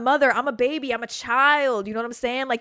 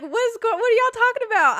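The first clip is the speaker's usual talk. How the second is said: high, loud, giddy squeal; happy, oblivious; 6 dB louder than usual. Retold or imitated. Kid to worried friends before seeing blood, giddy. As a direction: squeal it, blissfully clueless. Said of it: "I'm all happy as fuck".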